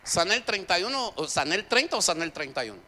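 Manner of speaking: high and loud, pressing questions at the crowd; full-force preaching. Acted. Preacher challenging the congregation about the day of healing, loud.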